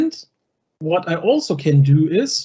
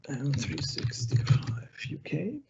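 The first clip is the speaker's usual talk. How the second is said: much softer, spoken half to oneself; murmuring while typing; 3 semitones lower, 10 dB quieter than usual. Real, not acted. Presenter entering a table name during a demo, murmuring. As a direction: quietly sound out what you are typing.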